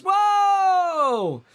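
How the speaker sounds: loud, high whoop of surprise; a real shout of delight at a big tip; surprised whoa. Real, not acted. Reacting to Rick's twenty-dollar donation, a real high shout of surprise.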